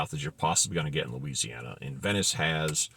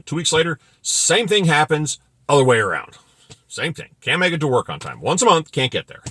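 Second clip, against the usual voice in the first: exasperated outburst; 9 semitones higher, 8 dB louder than usual. Real, not acted. Exasperated rant about late crews.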